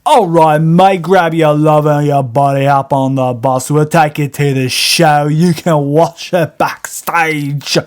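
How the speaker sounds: put-on British rocker accent. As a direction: grant the favor in a loud British rocker voice.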